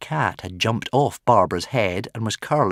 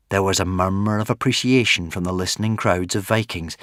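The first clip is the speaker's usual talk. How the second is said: usual speaking voice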